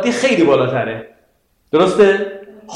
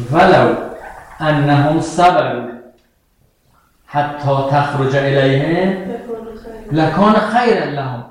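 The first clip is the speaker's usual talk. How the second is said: slow phrase-by-phrase recitation; 4 semitones lower, slower than usual. Shown as a demonstration. Teacher recites verse phrase by phrase.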